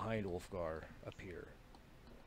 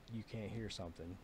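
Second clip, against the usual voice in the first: hushed, soft divine words; soft god voice; 8 dB quieter than usual. A narrator's reading. God quietly addressing the dying dwarf, hushed. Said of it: "basically says".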